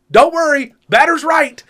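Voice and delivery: high, loud mocking call to the ump; taunting yell. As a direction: yell it across the field to needle him. Heckling umpire across the field.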